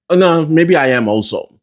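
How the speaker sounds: ordinary talk, nothing marked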